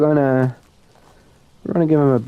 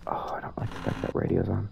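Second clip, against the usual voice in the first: hushed, nervous aside; uneasy whisper; 12 dB quieter than usual. Real, not acted. Player unsettled by the haunted radio, uneasy whisper. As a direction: say it very softly, half whispered.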